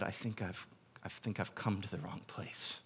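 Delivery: muttered under breath. Retold or imitated. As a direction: mutter it as a private realization. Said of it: "mutter something under his breath".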